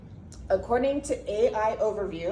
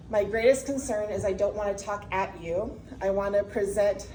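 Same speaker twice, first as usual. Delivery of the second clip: usual speaking voice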